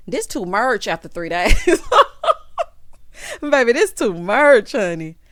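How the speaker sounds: cracking up while scolding; a loud laughing outburst. Real, not acted. Laughing at Justin.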